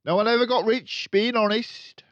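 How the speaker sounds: loud, high, scoffing dealer; cynical father. Acted. Father lecturing Matilda, loud cynical scoff. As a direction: dismiss honesty with a sneer. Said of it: "the father said".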